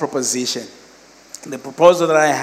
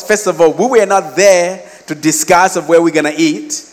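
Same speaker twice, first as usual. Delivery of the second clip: raised, emphatic retold protest; indignant arguing; 4 semitones higher, 3 dB louder than usual. Students refusing to split the bill, indignant. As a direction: lay down the case, higher and insistent. Acted argument over the bill.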